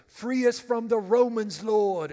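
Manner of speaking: loud, high mock-British cry; hammed-up British accent, called out loudly. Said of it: "they have a British accent".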